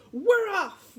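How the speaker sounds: high, loud crew cheer; crew shout. Acted. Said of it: "we all shouted".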